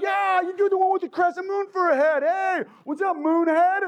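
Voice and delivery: high, shouted mockery; taunting bully. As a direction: yell the nickname to mock him.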